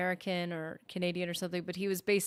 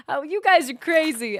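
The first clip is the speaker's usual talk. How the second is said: ordinary talk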